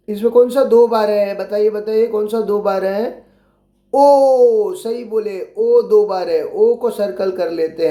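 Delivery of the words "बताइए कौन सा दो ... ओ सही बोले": ordinary talk, nothing marked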